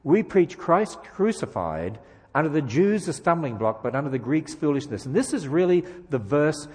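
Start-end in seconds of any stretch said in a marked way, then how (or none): none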